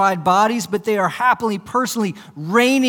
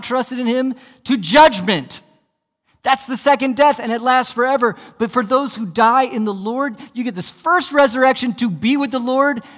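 higher, louder, insistent preaching; fervent preaching; 6 semitones higher, 4 dB louder than usual. Real, not acted. Preacher warning of the second death, fervent and loud. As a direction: warn and promise with fire.

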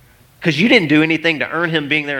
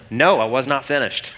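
ordinary delivery

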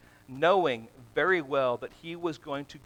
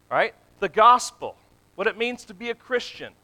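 raised, louder preacher's voice; forceful preaching; 5 semitones higher, 8 dB louder than usual. Real, not acted. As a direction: drive it home from the pulpit, loud and high. Preaches with rising force about sin.

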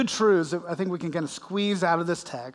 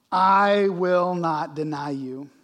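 slow, louder, firm refusal; emphatic vow; 5 dB louder, slower than usual. Retold or imitated. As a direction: plant each word like an oath. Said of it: "But he said emphatically".

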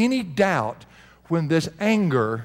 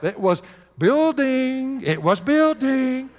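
high and slow, stretching building; 9 semitones higher, slower than usual. Acted. Preacher acting out rising anger, slow and high. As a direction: let building swell slowly and high.

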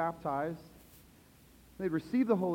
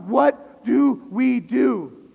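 loud, very slow, drawn-out question; anguished crowd cry; desperate cry; 2 semitones higher, 9 dB louder, much slower than usual. Acted. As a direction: cry it out in need.

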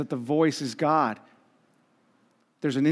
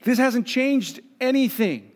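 high, strained complaint, slowing at the end; exasperated Elijah; 9 semitones higher than usual. Retold or imitated. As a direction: whine that nothing has changed. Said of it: "Elijah's like".

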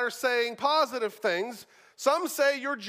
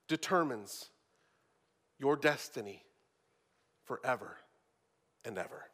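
preacher warning the beloved, low and fading to near silence; hushed sermon appeal; 9 semitones lower, 5 dB quieter than usual. Acted. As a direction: lower and softer, fading away toward the end.

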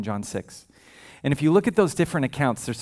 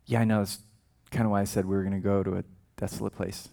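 dry Jesus; 7 semitones lower, 6 dB quieter than usual. Retold or imitated. Jesus answers disciples dryly.

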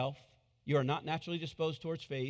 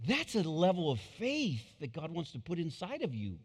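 ordinary talk, nothing marked

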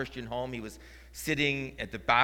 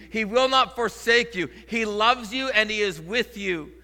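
louder, higher, driving sermon voice; full-force preaching; 9 semitones higher, 9 dB louder than usual. Acted. Pastor urging the congregation, loud fervent preaching. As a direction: preach it out with full conviction to the church.

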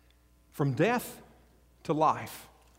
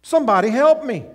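a desperate mock cry, higher and louder; crying out for help; 6 semitones higher, 7 dB louder than usual. Saul, blinded, crying out to anyone for help. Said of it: "probably crying out and saying".

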